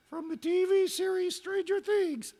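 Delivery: very high, put-on dog voice; talking dog. Pet dog answers why she is called Eleven, very high talking-dog voice. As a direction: answer in a squeaky falsetto as the dog. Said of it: "Oh, it's a talking dog".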